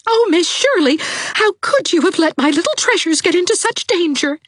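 high, loud, near-hysterical mother; a frantic mother accusing the teacher; shrill, loud cry of an overwrought mother; hysterical lady. A narrator's reading. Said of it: "almost hysterical lady".

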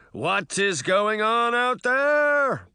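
high, loud, drawn-out bellow; outraged put-on voice, stretched out; booming. A narrator's reading. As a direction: boom it slowly from behind a door. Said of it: "a voice suddenly boomed".

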